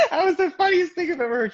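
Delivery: high laughing words; giggling through the line. Real, not acted.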